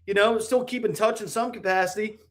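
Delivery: mild excited self-quote; excited brag. Retold or imitated. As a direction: brag happily about a friend. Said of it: "I'm like".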